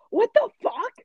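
high, loud exasperated outburst; retold inner outburst. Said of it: "In my head, I was saying it out loud".

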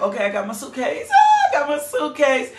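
mock excited church member, laughing; eager congregation. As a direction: play the excited church folk packing up. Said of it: "My people are like".

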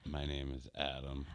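hushed seductive whisper; sexy whisper. Pretend. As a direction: whisper your name like a flirt. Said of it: "our sexy voices right now?".

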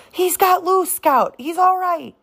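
high, excited shout of relief; relieved cry. A narrator's reading. Jem tells his sister the trapped man is free, thrilled and relieved. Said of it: "Jem cried".

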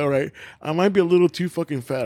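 mock resolution through giggles; amused self-mockery. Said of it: "I was like".

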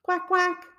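high quacking duck voice. A narrator's reading. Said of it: "quacked the duck".

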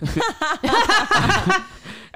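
loud, high helpless laugh; cracking up high and loud; high burst of laughter. Real, not acted. Friends laughing at the mechanic story, high burst of laughter.

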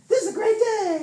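high, loud burst of enthusiasm; acting out giddy excitement; excited exclamation. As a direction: belt it out like an eager morning cheer. Voicing the morning's excitement.

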